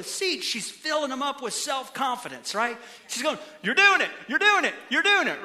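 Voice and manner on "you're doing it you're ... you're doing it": quick, high, loud imitation of a cheering parent; mock cheering yell. Wife cheering kids on their bikes, mock cheering yell. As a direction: cheer on a wobbly young cyclist. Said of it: "She's yelling".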